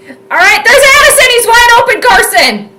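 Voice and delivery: rising high and loud as the play opens; eager live call climbing high; excited. Real, not acted. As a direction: call the throw as it happens, thrilled.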